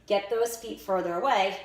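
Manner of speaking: ordinary talk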